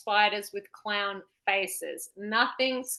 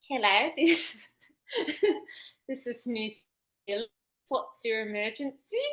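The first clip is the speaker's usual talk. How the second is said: New Zealand operator. Acted. Operator answering a New Zealand emergency call, light accent. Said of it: "Channeling New Zealand vibes".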